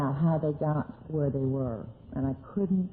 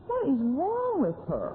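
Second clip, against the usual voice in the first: high, slow, baffled mock exasperation; pitched-up slow disbelief; exasperated thought; 9 semitones higher than usual. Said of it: "I'd think".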